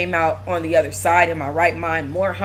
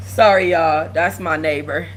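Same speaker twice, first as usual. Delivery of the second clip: normal talking voice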